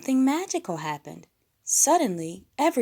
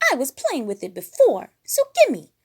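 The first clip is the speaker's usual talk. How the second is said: much higher, louder bratty voice; Piggy; 6 semitones higher, 8 dB louder than usual. A narrator's reading. Piggy contradicting Fozzie over the hat, high and loud. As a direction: snap back like a spoiled diva. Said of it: "said Piggy".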